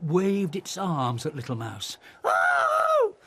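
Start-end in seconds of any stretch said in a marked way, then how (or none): 2.2-3.2 very high, loud frightened squeak. A narrator's reading. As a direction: jump with fright. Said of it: "squeaked Little Mouse".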